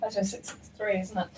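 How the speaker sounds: normal talking voice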